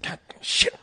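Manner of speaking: whispered, frustrated curse under breath; muttered curse. Retold or imitated. Imitating Redford cursing quietly on the sinking boat. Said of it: "The whole time he was like".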